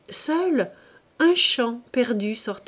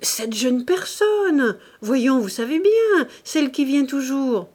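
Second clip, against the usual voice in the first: plus haut et plus fort, lent au début; concierge impatiente; 6 semitones higher, 6 dB louder than usual. Acted. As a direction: une concierge qui insiste. (madame Joseph explique à Claude, haut et insistante)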